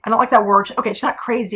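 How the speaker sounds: ordinary talk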